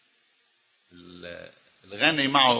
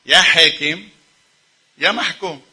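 mocking; 6 semitones higher, 6 dB louder than usual. Real, not acted. Mocking ruler-ruled divide.

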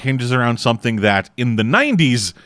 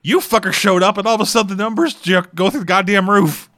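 exasperated Irish local; 8 semitones higher than usual. Retold or imitated. An Irish local ranting at visiting Americans, high mock exasperation. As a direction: voice a fed-up local venting at tourists, pitched up. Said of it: "It's like".

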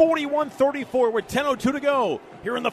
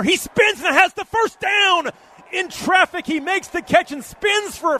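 excited; 7 semitones higher, 5 dB louder than usual. Real, not acted. First down catch called.

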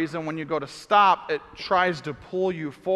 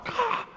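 exasperated cry; 6 dB quieter than usual. Retold or imitated. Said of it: "and I'm like".

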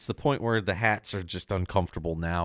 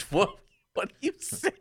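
very high, thin disbelieving question; incredulous; 11 semitones higher than usual. Retold or imitated. Listener reacting to the president's claim, incredulous. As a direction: squeak it out in disbelief. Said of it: "And I was like".

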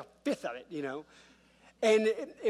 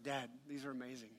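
hushed, much softer awe; quiet mock awe; 4 semitones lower, 14 dB quieter than usual. Dad quoting his son's praise of pancakes, quiet awe. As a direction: murmur it in small-kid wonder.

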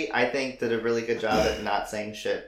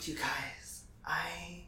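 hushed unvoiced whisper; whispering on the phone; 4 semitones higher, 15 dB quieter, much slower than usual. Real, not acted. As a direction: whisper so nobody wakes. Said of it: "Why are you whispering?".

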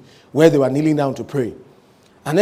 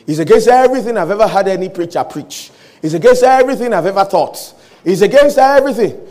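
raised, building protest; insistent protest; 4 semitones higher, 6 dB louder than usual. Acted. Hagin objects to Jesus about his theology, insistent and louder. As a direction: protest harder each time, rising to a loud peak.

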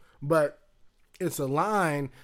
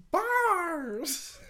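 high mom voice; 3 dB quieter than usual. Retold or imitated. Mom's hype call again.

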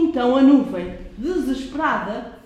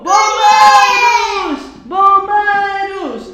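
loud, high, drawn-out call; 7 semitones higher, 9 dB louder, much slower than usual. A narrator's reading. Mother calling the firemen from the ground, loud and drawn-out. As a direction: shout for the firemen, long and sung. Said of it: "a mãe gritou".

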